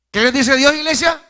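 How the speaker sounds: loud, high call to the congregation. Real, not acted. Preacher calling out to his church, loud and high. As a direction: throw the question out to the church.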